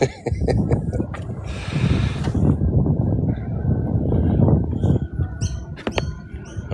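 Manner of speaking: long wheezy laugh. Real, not acted. Tourist amused by his own accent attempt, laughing. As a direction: crack up at your own silliness.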